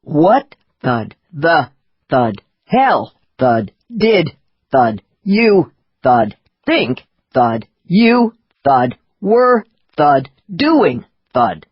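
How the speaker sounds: every word hammered out separately, each one followed by a thud; angry man spacing out each word with a cane thud. A narrator's reading. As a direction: stamp out each word with a beat between them. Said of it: "using his cane to emphasize each word".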